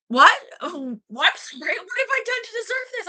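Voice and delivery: high, fast theatrical dismay; mock outrage. Woman hammering at a stolen barrel, a high mock lament. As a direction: wail it to the heavens like a wronged victim.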